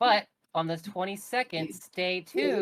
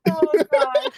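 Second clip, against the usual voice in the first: much higher and louder, shrieking; excited disbelief; 9 dB louder than usual. Real, not acted. Friend shrieking at the helium voice. Reacting to funny voice.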